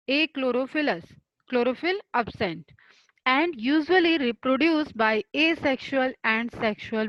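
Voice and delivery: very slow, each word spaced apart; slow dictation. Shown as a demonstration. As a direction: read the definition out for notes. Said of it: "definition of fungi". (बहुत धीरे लिखवाते हुए)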